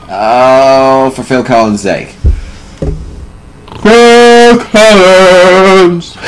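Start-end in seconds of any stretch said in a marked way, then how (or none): 3.8-6.2 long, high, loud drawn-out cry; exasperated high drawn-out wail; drawn-out yell. Real, not acted. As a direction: stretch the name out in a wail. Exasperated mock curse.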